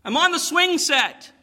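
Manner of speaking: high hollered reply; calling back. Acted. Drunk stranger answers from the garden, calling back. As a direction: holler it high from across the yard.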